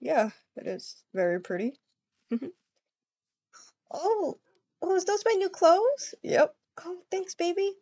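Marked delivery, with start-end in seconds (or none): none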